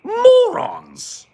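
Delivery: mock British contempt, swooping high; haughty Brit. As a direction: sneer in a hammed-up British accent. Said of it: "That same dude would be like".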